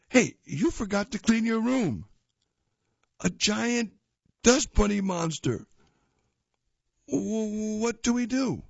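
alarmed cartoon kid. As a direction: panic lightly like a cartoon kid.

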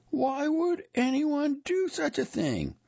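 very high, slow wailing voice; woman's anguished cry. A narrator's reading. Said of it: "the woman cried".

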